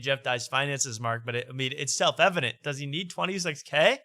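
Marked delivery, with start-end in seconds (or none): none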